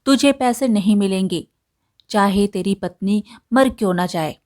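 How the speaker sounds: ordinary delivery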